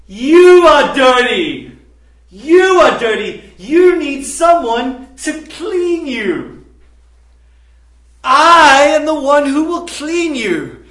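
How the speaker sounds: impassioned preacher voicing Christ; voicing Jesus. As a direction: declare it like a prophet to the crowd. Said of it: "because Jesus is saying".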